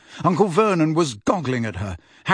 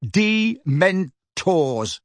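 "Dementors" drawn out syllable by syllable, higher; slow and clear; 5 semitones higher, much slower than usual. A narrator's reading. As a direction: spell out "Dementors" slowly, each syllable separate. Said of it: "slowly and clearly".

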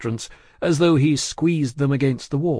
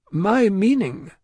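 slow, high, tactful reply; hesitant diplomatic banker; careful man of business; 8 semitones higher, slower than usual. A narrator's reading. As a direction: pick the words cautiously. Lorry hedges before offended client.

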